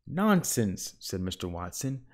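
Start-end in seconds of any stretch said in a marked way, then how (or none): 0.1-0.9 unsure nonsense. A narrator's reading. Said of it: "did not sound very sure".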